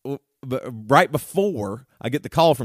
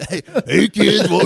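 mock creepy stranger; 5 semitones higher, 5 dB louder than usual. Dad mimicking how he called to his kids from the van, as a mock creepy stranger. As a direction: call out like a cartoon creep. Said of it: "somebody really talked like that".

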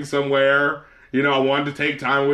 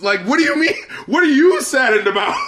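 laughing outrage; 9 semitones higher than usual. Real, not acted. Scolding the apologizing celebrity, high laughing outrage. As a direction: rant in amused disbelief.

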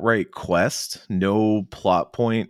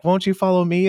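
high, coy, louder invitation; playful flirty dancer voice; sing-song dancer; 12 semitones higher, 5 dB louder than usual. Retold or imitated. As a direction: lure them along sweetly.

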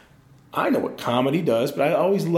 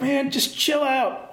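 exasperated plea; 12 semitones higher, much slower than usual. Retold or imitated. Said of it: "you're like".

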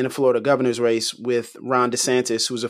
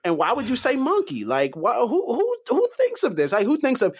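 incredulous; 10 semitones higher than usual. Real, not acted. Cohost baffled by the monkey comment, high incredulous. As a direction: pitch shoots way up in disbelief.

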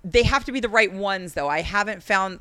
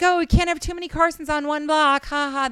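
fake cheery cover; 8 semitones higher than usual. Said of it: "I was like".

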